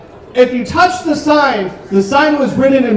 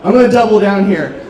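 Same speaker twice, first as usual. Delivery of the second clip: ordinary talk, nothing marked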